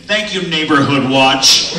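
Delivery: slow, loud sarcastic jab; sarcastic thanks. Real, not acted. To the absent neighbors, slow loud sarcasm. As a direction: drawl it out mockingly.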